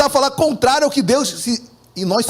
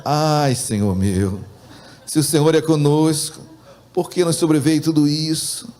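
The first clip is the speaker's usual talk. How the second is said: low, downcast sigh to God; changed, lamenting intonation; 6 semitones lower than usual. Acted. Preacher reads Gideon's reply to the angel, low lament. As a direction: read it as a weary complaint, pitched low. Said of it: "dá até mudar o, a entonação".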